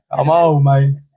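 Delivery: ordinary talk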